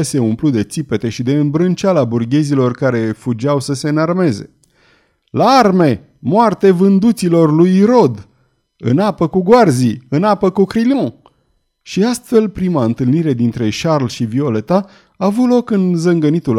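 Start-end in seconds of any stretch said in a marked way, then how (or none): 5.3-11.1 loud, high battle cries; furious yelling. A narrator's reading. Furious crowd calling the townsfolk to arms, yelling. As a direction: raise the mob's rallying cry, voice pitched up. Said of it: "urla de furie".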